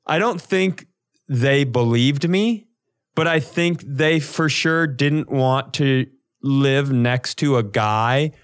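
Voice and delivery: ordinary talk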